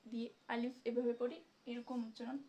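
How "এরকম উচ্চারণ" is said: normal talking voice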